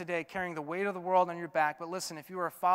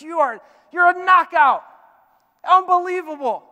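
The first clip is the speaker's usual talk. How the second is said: much higher and louder, thrilled; God voiced as a thrilled, proud father; delighted exclamation; 12 semitones higher, 17 dB louder than usual. Voicing God admiring.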